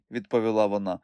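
usual speaking voice

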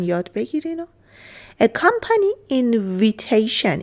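normal talking voice

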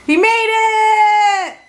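high, slow, joyful cheer; excited cheer. Real, not acted. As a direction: cheer him on, overjoyed. Mom cheering dad finishing.

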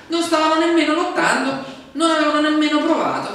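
loud, high insistence; emphatic preaching. Real, not acted. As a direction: drive it home with force. Preacher stressing they did not fight. (predica enfatica, forte e acuta)

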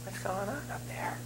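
soft whispered wondering. Retold or imitated. Wife wondering from rows back, whispered. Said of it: "wife is wondering".

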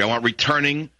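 ordinary talk

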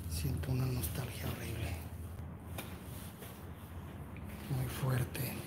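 whispering so the house won't hear; hushed whisper. Real, not acted. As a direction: keep it barely voiced, hushed and careful. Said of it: "No puedo hablar muy fuerte".

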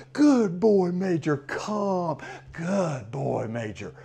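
big love praise. Shown as a demonstration. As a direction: pour praise over the dog. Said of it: "I just get big love".

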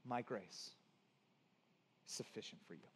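sudden drop to low, very soft voice; soft, low and unhurried; hushed divine promise. Acted. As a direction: let God's promise land quietly, with pauses. God's promise voiced after loud build.

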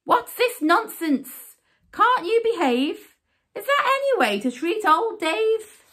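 high, indignant scolding; outraged storybook knight's reprimand; furious knight. A narrator's reading. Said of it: "a furious knight with eyes aflame".

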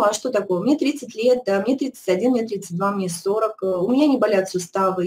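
ordinary talk, nothing marked

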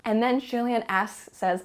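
ordinary talk, nothing marked

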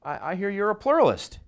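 high, bright feigned casual opener; playing a chirpy guest at a party; mock party opener. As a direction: act the friendly partygoer. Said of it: "push them a little bit".

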